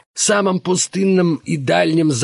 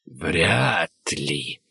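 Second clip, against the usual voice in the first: soft, much lower breathy rustle; rustling whisper; 11 semitones lower, 6 dB quieter than usual. Acted. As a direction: barely breathe the words. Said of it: "прошелестели бескровные губы".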